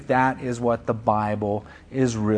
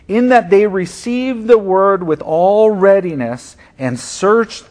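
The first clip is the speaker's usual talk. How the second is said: raised, slowed reading of the verse; scripture reading; 5 semitones higher, 5 dB louder than usual. Acted. Pastor reading Acts seventeen to the church, lifted and deliberate. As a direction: read the passage out boldly, stretching the key lines.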